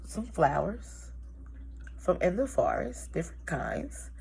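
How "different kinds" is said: soft whispered aside. Real, not acted. Storyteller whispering an aside to children. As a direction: breathe it like a secret.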